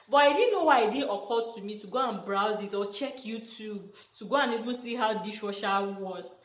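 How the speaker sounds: ordinary talk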